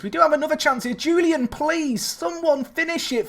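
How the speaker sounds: high, pleading cry for someone to finish it; excited pleading. Real, not acted. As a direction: beg the players to score, voice way up. Pleading for a goal, commentating.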